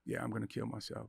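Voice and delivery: hushed, low resigned voice; quiet despair. Retold or imitated. Driver quietly resolving to end it at speed. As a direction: say it softly, giving up. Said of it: "and I'm going".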